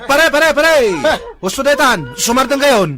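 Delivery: high, loud angry shouting; angry yelling. Acted. Man yelling at the brawling couple, high furious shout. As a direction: yell at them to break it up. Fight breaks out with vendor.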